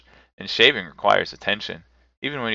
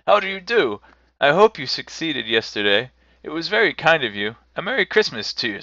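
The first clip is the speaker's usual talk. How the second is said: higher, animated character voice; eager, friendly Scrooge; 7 semitones higher, 4 dB louder than usual. A narrator's reading. Scrooge greets the old gentleman, eager and hearty. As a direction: greet him heartily, pitched up.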